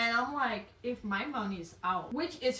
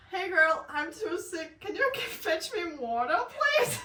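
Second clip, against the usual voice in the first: pitiful sick plea; 7 semitones higher, 4 dB louder than usual. Retold or imitated. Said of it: "I write to her and be like".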